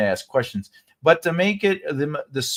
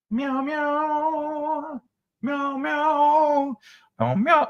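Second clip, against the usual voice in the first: high sing-song meowing; playful high kitty meows; cat meows; 13 semitones higher, much slower than usual. Pretend. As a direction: meow up at the cat like a kitten.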